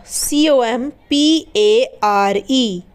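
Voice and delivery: very slow spelling, letters spaced apart; spelling slowly. Shown as a demonstration. Teacher spelling compare slowly for students. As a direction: lay out each letter slowly. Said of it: "क्या पढ़ते हैं इसे?".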